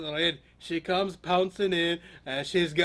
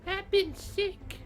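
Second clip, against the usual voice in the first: slow, high, soft falsetto; feigned sickly Grandma; 14 semitones higher, 4 dB quieter, much slower than usual. Acted. Wolf faking illness as Grandma, slow high voice. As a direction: play sick in a weak granny falsetto.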